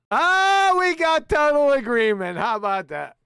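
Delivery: loud jubilant outburst; exuberant mock celebration. Real, not acted. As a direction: whoop it up, then ease off.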